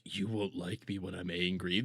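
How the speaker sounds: mock tough guy quote. Streamer reading a chat joke in a low mock growl. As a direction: drop the voice low to mock a hard man. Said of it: "Bright Mayor over on Kick says".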